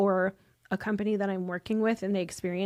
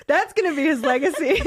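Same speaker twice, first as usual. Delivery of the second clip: laughing through a teasing line, higher and louder; laughing joke; 6 semitones higher, 7 dB louder than usual. Real, not acted. Joking to the host about Mike's age, laughing. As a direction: tease him while cracking up.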